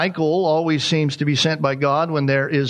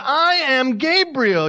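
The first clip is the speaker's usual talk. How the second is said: grand, slow self-announcement, pitched high; lofty and drawn out; Gabriel; 9 semitones higher, slower than usual. Acted. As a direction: proclaim your name like an affronted angel. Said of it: "what kind of voice he said that".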